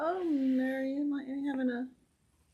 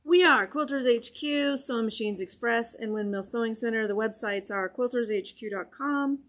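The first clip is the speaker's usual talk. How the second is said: a lightly voiced announcer listing the sponsors; announcer sponsor read; 4 dB louder than usual. Host introducing the shops to viewers, announcer style. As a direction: read the shop names like a brisk promo.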